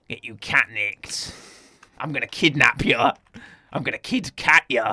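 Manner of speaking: lightly voiced thuggish cat; Vinnie Jones' cat. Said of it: "And then it goes".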